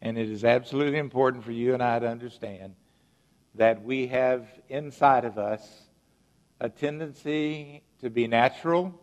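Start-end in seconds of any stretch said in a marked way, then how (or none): none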